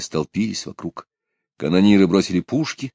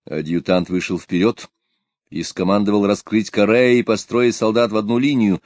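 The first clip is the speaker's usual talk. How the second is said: ordinary delivery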